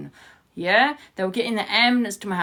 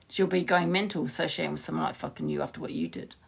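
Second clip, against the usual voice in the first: ordinary talk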